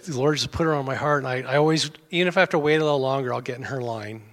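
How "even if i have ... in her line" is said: ordinary talk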